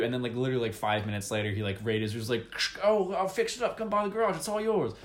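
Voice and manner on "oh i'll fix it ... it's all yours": high, chipper voice of an eager mechanic; lightly hammed cheerful helper on the radio; cheery mechanic. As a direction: play the overly helpful garage guy. Mechanic character radios the player.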